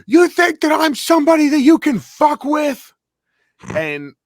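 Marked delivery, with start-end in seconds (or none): 0.1-2.9 screamed high and loud, in his face; furious shout. Retold or imitated. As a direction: yell it like a man spoiling for a fight. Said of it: "He's going".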